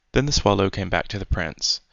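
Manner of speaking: ordinary delivery, nothing marked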